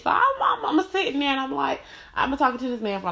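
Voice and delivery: worked-up disbelief. Retold or imitated. Woman recounting the news of his new baby, high-pitched disbelief. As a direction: voice shoots way up in disbelief, then starts sliding down. Incredulous retelling of learning about baby.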